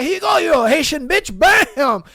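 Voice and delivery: high, hammed-up strike line; mock attack. Cook hitting rival with a sheet, mock angry. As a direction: act out a blow, ending loud on the impact.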